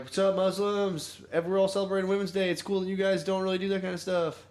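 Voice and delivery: a hint of a smug politician; mock politician. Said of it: "Just to be like".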